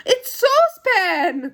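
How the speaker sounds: high, loud, drawn-out exclamation; excited cry. Acted. Joe recognises Saucepan's silly song, excited cry. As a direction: cry out in delighted recognition, slowly stretched. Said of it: "cried Joe".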